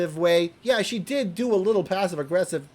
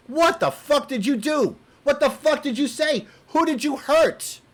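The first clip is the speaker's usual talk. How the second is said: high, angry demands; furious; 6 semitones higher than usual. Retold or imitated. Furious at Mandisa, demanding high and angry answers. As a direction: fire the questions at her in fury.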